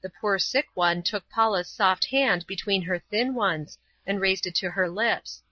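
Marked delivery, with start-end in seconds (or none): none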